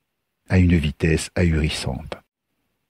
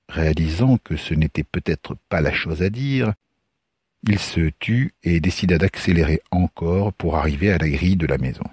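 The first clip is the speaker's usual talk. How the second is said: ordinary delivery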